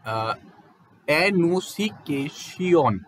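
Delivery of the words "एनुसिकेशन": एनुसिकेशन dragged out slowly, syllable by syllable, garbled; mangled pronunciation. Shown as a demonstration. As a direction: stumble slowly through एनुसिकेशन as if misreading. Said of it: "अगर मैं ऐसे इस तरह से आपसे के सामने पढ़ने लगूँ".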